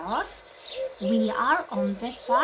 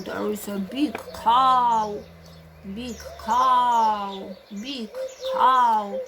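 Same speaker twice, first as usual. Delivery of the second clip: excited cow; 5 dB louder, slower than usual. Acted.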